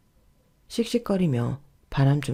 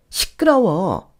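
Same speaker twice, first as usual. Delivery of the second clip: slow, drawn-out irritated hush; irritated shutdown; 3 dB louder, much slower than usual. Acted. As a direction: cut them off with one stretched-out scolding word. 어머니가 며느리 말을 끊음. (짜증스럽게 길게 끌며 말 끊기)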